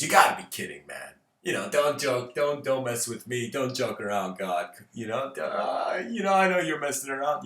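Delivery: loud, animated joker protesting; incredulous joker. Acted. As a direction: protest loudly, as if calling someone's bluff. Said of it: "the Joker looks at him".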